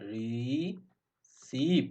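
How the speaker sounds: Receive stretched out very slowly; very slow demo. Shown as a demonstration. Teacher slowing down receive for students. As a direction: draw out receive syllable by syllable.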